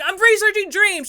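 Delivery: high, loud defensive excuse; insist, caught out. Retold or imitated. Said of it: "You're like".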